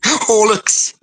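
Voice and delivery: "Horlicks" said in a broad Welsh accent; "Horlicks" with a thick Welsh lilt. Real, not acted. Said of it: "thick Welsh accent".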